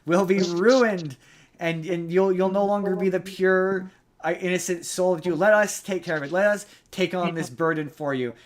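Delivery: badly faked Irish brogue pleading with him; terrible Irish accent. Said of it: "I'm doing a terrible Irish accent".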